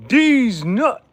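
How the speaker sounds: triumphant French number; excited shout. Real, not acted. Proud shout of remembered number.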